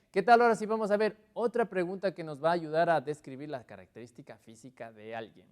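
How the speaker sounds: usual speaking voice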